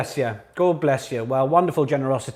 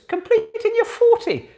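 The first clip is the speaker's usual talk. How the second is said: excited; 7 dB louder than usual. Real, not acted. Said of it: "excited high wrap-up".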